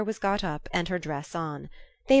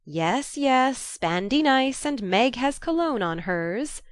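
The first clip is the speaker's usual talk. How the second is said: Jo calling back; 6 semitones higher, 3 dB louder, slower than usual. A narrator's reading. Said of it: "cried Jo".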